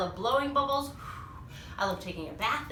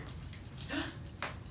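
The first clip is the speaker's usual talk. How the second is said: gasp; 5 semitones higher, 13 dB quieter than usual. Acted. Dramatic reveal in story.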